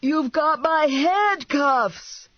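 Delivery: high, drawn-out holler; indignant yell. A narrator's reading. Man to fugitive driving off with his cuffs, indignant yell. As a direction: holler it slowly after a departing car. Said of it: "Clown yelled to Bender".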